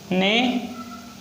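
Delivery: slow, soft model word neighbourhood; slow demo. Shown as a demonstration. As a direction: say neighbourhood slowly and softly for students. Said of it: "words की आपको अच्छे से practice करनी है".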